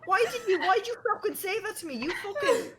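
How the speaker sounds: high, fast, laughing outburst; laughing disbelief. Retold or imitated. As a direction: laugh through the protest. Laughing disbelief at mom's question.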